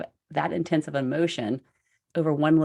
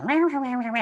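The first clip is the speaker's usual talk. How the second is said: mock angry growl; 9 semitones higher than usual. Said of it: "I was like".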